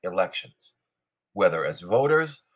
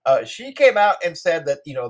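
usual speaking voice

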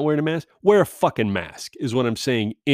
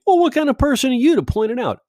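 mock skeptic; 12 semitones higher, 5 dB louder, faster than usual. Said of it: "you might be thinking".

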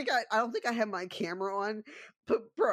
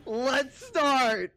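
mock him by matching his voice exactly; mimicking his voice; 5 semitones higher, 4 dB louder than usual. Tim throws the opponent's own line back, mocking mimicry. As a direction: copy the opponent's tone back, higher and louder. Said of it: "I matched this motherfucker's voice".

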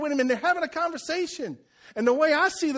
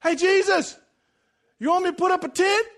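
loud, high call to get attention; eager blurting; 7 semitones higher, 5 dB louder than usual. Acted. Peter interrupts Jesus on the mountain, loud eager blurt. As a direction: blurt it out too loudly, pitched up.

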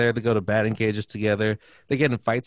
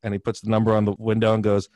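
ordinary talk

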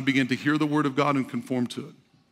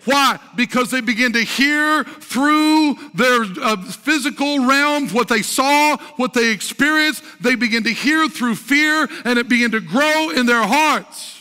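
higher, driving sermon voice with drawn-out key words; impassioned preaching; 7 semitones higher, 4 dB louder than usual. Real, not acted. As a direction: preach it out emphatically to the congregation. Preaches at full force.

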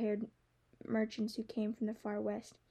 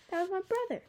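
much higher-pitched outburst; indignant protest; 9 semitones higher than usual. Acted. Girl defending her brother to the dragon, indignant. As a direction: object in an offended, raised pitch.

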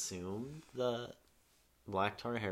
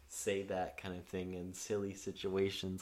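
usual speaking voice

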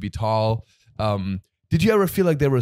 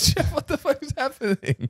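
much higher, incredulous mock outburst; shocked; 13 semitones higher than usual. Host retells reacting to Jeff flirting with her mom, high and shocked. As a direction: squeal it in disbelief. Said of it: "And I was like".

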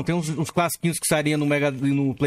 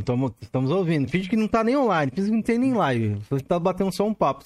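ordinary delivery

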